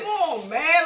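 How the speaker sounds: mock-exasperated outburst; retold frustration. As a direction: act out losing patience. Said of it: "he's getting frustrated".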